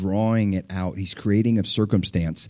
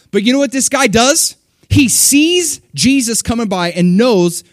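fervent preaching; 10 semitones higher, 6 dB louder than usual. Acted. Preacher urging the congregation to cry out, fervent. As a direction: preach it with fire.

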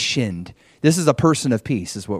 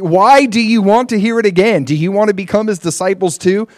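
high, loud, incredulous questioning; exclaiming; 8 semitones higher, 6 dB louder than usual. A narrator's reading. Healed man needling his questioners, exclaiming. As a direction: push the questions up high and hard. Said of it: "the man exclaimed".

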